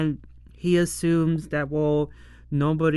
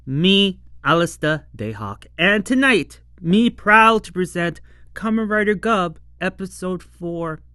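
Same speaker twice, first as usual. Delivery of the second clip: big hammed-up host announcement; mock theater host introduction; 2 semitones higher, 6 dB louder than usual. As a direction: present the show grandly, punching it out.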